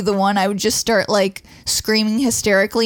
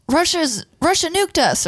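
South Park guy voice; 8 semitones higher than usual. Retold or imitated. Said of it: "like that guy from South Park going".

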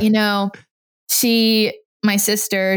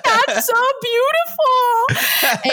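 hammed-up teary gush, much higher and very slow; fake-cry it like an actress cueing tears; crocodile tears; much slower than usual. Godmom moved by the sister's words, hammed-up weepy gush. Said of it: "my godmom starts crying 'cause she's so, she's like an actress".

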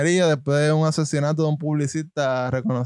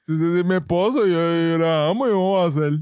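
mock pleading husband; faster than usual. Mimicking accused husband's denial.

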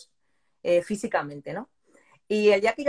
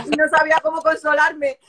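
laughing; 8 semitones higher, 9 dB louder than usual. Real, not acted. Laughing while retelling emotion.

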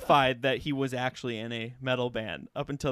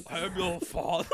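Darth Vader; 4 dB quieter than usual. Retold or imitated. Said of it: "try to do a German Darth Vader".

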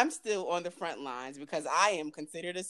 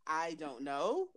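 ordinary talk

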